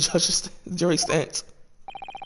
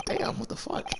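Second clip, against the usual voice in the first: laughing; 4 semitones higher, 10 dB quieter than usual. Real, not acted. Laughing reaction to game line.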